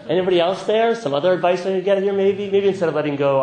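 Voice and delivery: loud, high comic plea for alternatives; mock bargaining. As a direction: shop around for a way out, hammed-up. Acting out the part that refuses.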